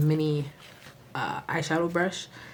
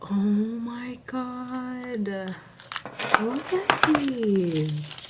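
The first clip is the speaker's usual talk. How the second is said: higher, very slow and drawn out; awed excitement; 6 semitones higher, much slower than usual. Real, not acted. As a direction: linger over each word in awe. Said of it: "I'm so excited".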